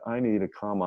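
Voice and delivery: usual speaking voice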